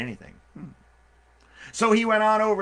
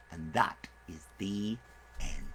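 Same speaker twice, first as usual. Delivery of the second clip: usual speaking voice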